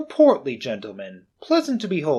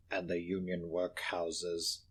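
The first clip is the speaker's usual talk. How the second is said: low, slow, hushed reply; cold old miser; 8 semitones lower, 14 dB quieter, slower than usual. Acted. Scrooge telling the gentleman of Marley, low and cold. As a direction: answer flatly and coldly.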